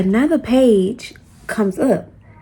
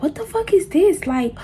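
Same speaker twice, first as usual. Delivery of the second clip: confronting him; 4 semitones higher than usual. Retold or imitated. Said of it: "screenshotting his messages to him like".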